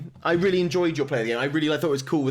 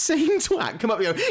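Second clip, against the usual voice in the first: ordinary talk, nothing marked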